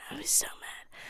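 put-on breathless whisper of old anger. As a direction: whisper it like a secret. Retelling anger about the bike.

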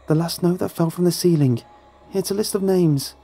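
put-on high-pitched, earnest child; high young voice; child's voice. A narrator's reading. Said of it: "the child responded".

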